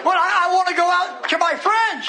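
high, pleading demon voice; hollering devil. Retold or imitated. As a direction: call out like a demon bargaining to leave. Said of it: "the devil hollered to Jesus".

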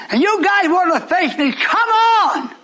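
high, rising cry to "come on"; defiant challenge. Real, not acted. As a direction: dare them, climbing to a shout. Preacher challenges opponents.

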